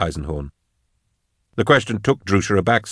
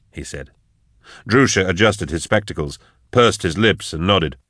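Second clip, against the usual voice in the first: ordinary delivery, nothing marked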